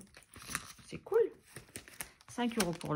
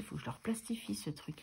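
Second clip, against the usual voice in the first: whisper; 2 semitones lower, 6 dB quieter than usual. Real, not acted. Muttered aside to herself.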